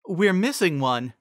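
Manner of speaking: high, noting it; high teacher voice. A narrator's reading. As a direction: say it high and plain. Cheerilee voiced.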